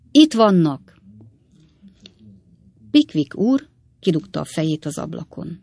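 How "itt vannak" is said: sudden excited call, a bit louder and higher; excited shout. A narrator's reading. Wardle shouting to Pickwick that he sees the runaways, excited. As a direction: cry out that they've spotted them. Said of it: "rettentő izgatottan így kiáltott".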